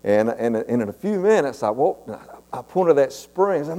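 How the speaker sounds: ordinary talk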